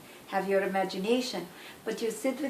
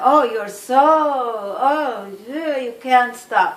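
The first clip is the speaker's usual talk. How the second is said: hammed-up breathless lust, much louder; mock passionate moaning; 4 semitones higher, 13 dB louder than usual. Lover in the kitchen who won't stop, hammed-up heated moans. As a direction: play the partner carried away mid-kiss, loud and heated. Partner pulling clothes off, passion.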